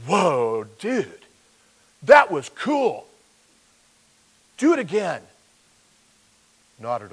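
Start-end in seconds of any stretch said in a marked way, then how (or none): 0.1-5.2 high, loud, goofy dude voice; hammed-up thrilled bro, egging it on; a laid-back dude gushing, much higher; mock surfer dude. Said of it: "get up and say".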